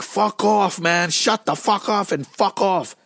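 high yelled fuck off, slow on the last line; retold angry yell. Singer yelling at his manager to stop the show, high angry yell. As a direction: fling it at him like you've lost it. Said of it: "I screamed at him".

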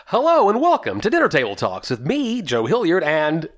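hammed-up podcast host greeting; performance tone. Shown as a demonstration. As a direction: announce it like a radio show. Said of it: "it's my turn to, to come in and say hello".

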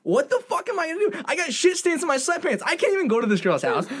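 high, fast frantic rant; high rapid frantic panic; mock panic. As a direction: spiral up in panic.

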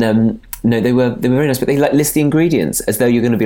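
ordinary delivery

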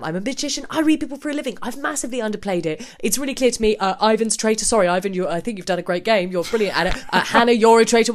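imitated unstoppable rush of blurting; mock manic torrent, hopping from apology to accusation; word vomit. Tom unloads on the whole table, unstoppable word vomit. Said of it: "like, word vomit".

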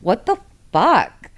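slow, stretched-out what the hell; mock outrage at the book; drawn-out disbelief. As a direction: drag each word out in shock. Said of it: "I'm just like".

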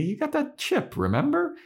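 high, sing-song taunt; mocking Buffy. Buffy mocking Spike about his chip, high sing-song. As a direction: tease him like he's a fool. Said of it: "And Buffy's like".

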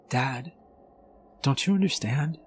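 a hint of a small daughter pleading; daughter's voice. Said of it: "the soft voice of my daughter".

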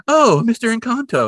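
high, cooing oh; imitated delighted landlady; mock surprised greeting. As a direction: pitch it way up as if happening upon him. Mother voiced, suddenly greeting the neighbor.

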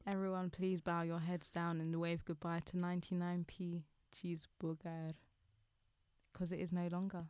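mock eulogy.

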